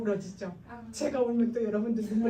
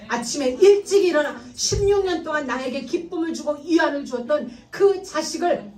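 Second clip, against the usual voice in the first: tearful preaching; 4 semitones higher, 7 dB louder than usual. Real, not acted. Preacher to the congregation, tearful and pleading. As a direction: voice thick with crying as the words come out louder. Preacher pictures Abraham sending son away.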